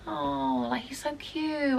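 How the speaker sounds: charmed cooing. Retold or imitated. Doorwoman watching the lad beg to talk to her, soft charmed coo. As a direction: coo as if he's adorable. Melting at the boy's pleading.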